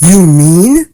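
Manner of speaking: loud, astonished elephant; gasped. A narrator's reading. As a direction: gasp it out in loud amazement. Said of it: "Horton gasped".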